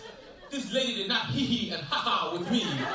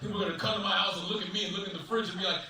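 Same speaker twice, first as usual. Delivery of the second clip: normal talking voice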